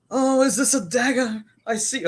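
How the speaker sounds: put-on British stage accent. Mocking British Julius Caesar productions, a high hammy British stage accent. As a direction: declaim it like a pompous British stage actor. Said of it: "they all talking British".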